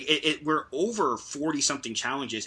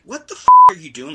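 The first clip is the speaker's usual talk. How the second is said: yelled far higher and much louder in outrage; furious shout; 14 semitones higher, 18 dB louder than usual. Real, not acted. Venting at the referees on the podcast, furious shout. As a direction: blow up at the referees. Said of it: "I was so angry at this".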